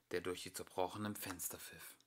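ordinary delivery

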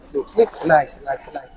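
low voice fading to very soft; hypnotic soothing. Real, not acted. Hypnotist sending the subject to sleep, soothing and fading. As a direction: lull them under, sinking into a hush.

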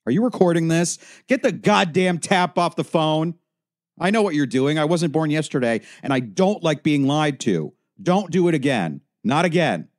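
high, loud, frantic accusations; frenzied angry outburst; paranoid fury. Acted. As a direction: shout them down, convinced you're being spied on. Paranoid outburst at the phone counsellor.

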